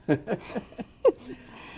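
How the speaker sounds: high, amused chuckle; real laugh at own thrift. Real, not acted. Amused at her tiny casts.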